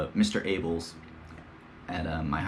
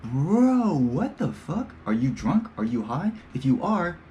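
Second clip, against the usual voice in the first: incredulous yelling; 7 semitones higher, slower than usual. Retold or imitated. Yelling at another driver.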